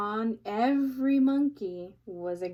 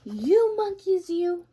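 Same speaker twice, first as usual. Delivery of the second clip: higher, louder scolding shout; angry peddler; 9 semitones higher, 9 dB louder than usual. Acted. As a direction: scold the monkeys, finger wagging. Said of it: "he said, shaking his finger".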